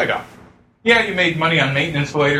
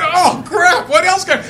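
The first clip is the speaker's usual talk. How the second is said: laughing mock panic; 12 semitones higher, 4 dB louder, slower than usual. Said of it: "and she went".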